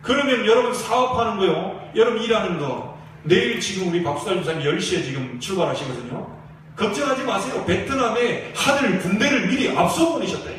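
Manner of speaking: fiery pastor rallying the congregation; preaching at full force. Acted. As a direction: drive it home to the church like a revival preacher.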